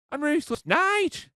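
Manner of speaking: high, exasperated protest; indignant protest. Acted. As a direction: insist on it, offended. Indignant about show name.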